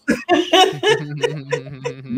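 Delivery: squealing high laugh. Real, not acted. Comic laughing at staring at a wall, high squeal of laughter. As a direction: laughs way up at his own punchline.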